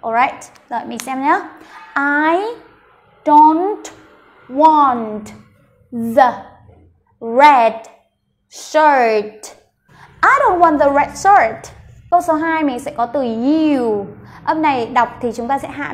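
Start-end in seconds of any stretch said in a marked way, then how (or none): 1.9-9.6 slow, each word separated by a clear pause. Shown as a demonstration. Teacher building the sentence for learners, word by word with long gaps. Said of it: "mình đọc cả câu". 10.2-11.8 whole sentence in one flow, higher pitched; fluent and confident. Shown as a demonstration. Teacher modelling the full sentence, fluent and joined up. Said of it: "thật là trôi chảy và thật là tự tin".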